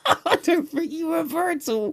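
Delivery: high, slow, laugh-choked voice; helpless laughter. Real, not acted. Gamer retelling his abuse of the troll, helplessly laughing. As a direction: choke it out through real laughter.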